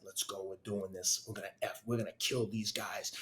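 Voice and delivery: soft, intent fighting talk to yourself; retold quiet self-psyching. His brain urging him on before racing, quiet determination.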